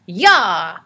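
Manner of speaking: loud sharp yell as he leaps; a fierce little war cry; battle yell. A narrator's reading. Morton pounces on Annabelle's skirt, yelling. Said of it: "Morton yelled".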